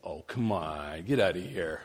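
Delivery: low, soft dismissive scoff; scoffing disbelief. Retold or imitated. Preacher recalls doubting the pastor's demon claim, scoffing. Retold sceptical reply to pastor.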